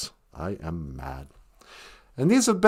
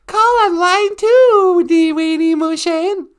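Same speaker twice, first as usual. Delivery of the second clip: high sing-song announcer; 7 dB louder, slower than usual. Acted.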